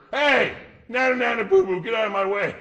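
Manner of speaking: grown-up taunting a shop clerk, hammed-up childish jeer; mock taunt. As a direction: tease the clerk like a kid, loud and high.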